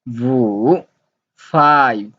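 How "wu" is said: dipping Mandarin tone on Wu; third tone. Shown as a demonstration. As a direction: draw Wu down and swing it back up. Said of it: "the third tone".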